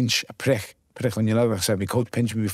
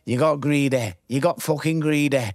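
put-on Sheffield accent; 6 semitones higher than usual. Trainer scolding the boxer in a mild Sheffield accent. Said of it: "he's got the worst accent".

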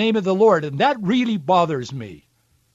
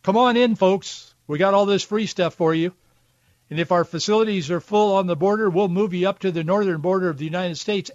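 ordinary delivery

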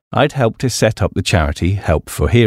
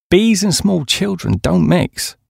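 higher, slow and knowing; Duncan's voice; 11 semitones higher, slower than usual. Acted. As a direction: give Duncan a raised, deliberate voice.

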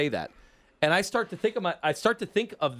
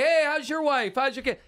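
high, chummy mock greeting; glad-handing pals; 9 semitones higher than usual. Racketeers shake hands with the cop, high-pitched chummy small talk. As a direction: gush small talk up high like old buddies. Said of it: "they're handshaking and like".

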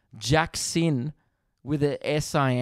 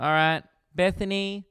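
higher, sing-out roll-call voice; teacher calling roll; 6 semitones higher, slower than usual. Acted. Teacher reading the register to grade one, high roll-call voice. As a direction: call the name out high to the class.

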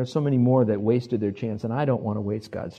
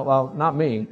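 ordinary talk

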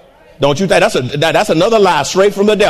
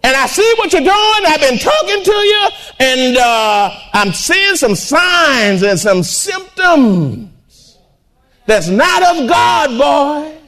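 full-force preaching; 4 semitones higher than usual. Acted. Preacher warning a wayward son, fervent. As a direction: preach it hard at the congregation.

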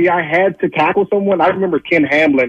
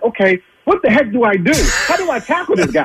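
high-pitched mock panic; flustered self-questioning; 9 semitones higher than usual. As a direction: retell it as a helpless, rising plea. Retelling own panic during the play.

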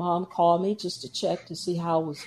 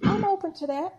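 much higher, lightly put-on agreement; mock eager reply; 12 semitones higher than usual. Deacon retelling her eager yes to the mom, high mock voice. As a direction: chirp it like a willing student. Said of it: "I was like".

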